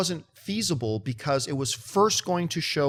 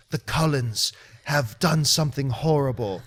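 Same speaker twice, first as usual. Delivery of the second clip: mock-dramatic low pronouncement; ominous film character; 5 semitones lower than usual. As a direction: intone it gravely, imitating the film. Voicing a film character's trailer line.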